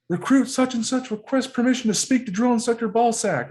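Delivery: high, loud formal recruit holler; top-of-lungs recruit yell. Acted. Marine showing how recruits hail the drill instructor, screamed. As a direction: belt out the request in one rigid run. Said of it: "scream it at the top of your lungs".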